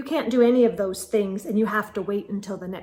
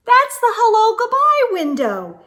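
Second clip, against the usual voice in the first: bright, high and louder, like sharing a secret; excited reveal; 11 semitones higher, 5 dB louder than usual. A narrator's reading. Child proudly introducing the special window, excited. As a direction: announce it with wonder.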